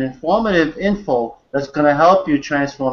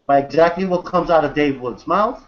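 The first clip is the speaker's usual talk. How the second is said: ordinary delivery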